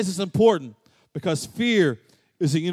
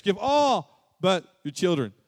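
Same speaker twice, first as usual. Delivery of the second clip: usual speaking voice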